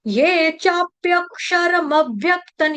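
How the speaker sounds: slow syllable-by-syllable Sanskrit; broken up slowly. Shown as a demonstration. Teacher teaching students to read, slow broken-up recitation. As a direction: stretch and separate each syllable. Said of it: "तोड़ तोड़ के पढ़ना".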